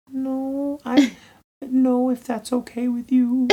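tiny, high meek voice; timid refusal. Retold or imitated. Younger self refusing someone years ago, meekly, pitched high. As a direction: squeak out a hesitant, apologetic no. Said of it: "maybe ten, fifteen years ago".

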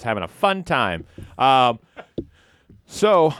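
usual speaking voice

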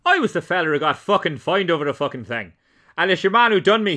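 furious Irish brogue, played for laughs; Dowley in Irish accent. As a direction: rant it in a broad Irish accent. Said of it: "vanish into the Irish dialect".

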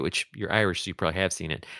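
ordinary talk, nothing marked

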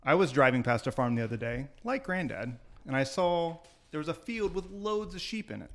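ordinary delivery

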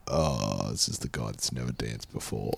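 low, very soft, trailing aside; retold disappointment. Dancer imitating disappointed partners sizing him up, deflated and very soft. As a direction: quote their sinking realisation under your breath.

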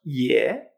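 low "ye" with a clear Spanish e. Shown as a demonstration. Teacher contrasting two Chinese vowels, "ye" with Spanish E. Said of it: "la E es como una E española".

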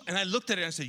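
normal talking voice

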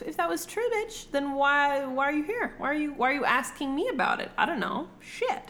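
high-pitched, incredulous comeback; indignant retort. Retold or imitated. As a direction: fire back questions in disbelief. Acted retort in retold quarrel.

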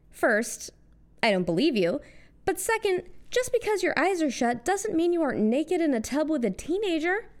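ordinary talk, nothing marked

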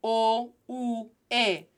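O, U, E as separate "oh, oo, eh"; pure Spanish vowels. Shown as a demonstration. Teacher repeating three vowel sounds, pure Spanish vowels. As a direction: space out O, U, E cleanly. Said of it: "Three vowel sounds".

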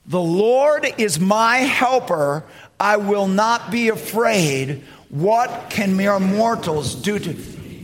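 slow, deliberate congregational reading; slow unison recitation. Shown as a demonstration. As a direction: say it slowly together with the church. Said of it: "Let's say this together".

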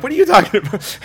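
high, loud mock indignation; mock disbelief. Preacher mocking his disbelief at having an accent, high and loud. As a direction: play at being baffled. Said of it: "I'm like".

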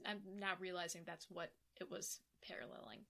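hushed realization whispered. Real, not acted. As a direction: say it under your breath. Whispered aside.

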